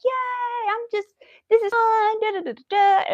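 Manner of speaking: high, bouncy voice of a gleeful little dog; happy dog voice. Pretend. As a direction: squeal it like a delighted puppy. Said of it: "he would just be like".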